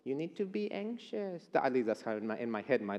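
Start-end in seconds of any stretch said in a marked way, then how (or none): 0.0-1.5 soft, slow, sneering taunt; the enemy's taunting voice. Acted. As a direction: whisper doubts at them like a sly tempter. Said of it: "I'm using this voice".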